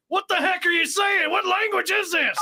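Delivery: high, confused shouting; baffled shout. Acted. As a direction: yell it in total confusion.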